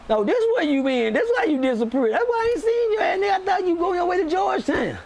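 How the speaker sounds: high-pitched, incredulous yelling; retold outburst. Confronting the friend who disappeared, retold high-pitched outburst. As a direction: shoot the pitch way up in mock outrage.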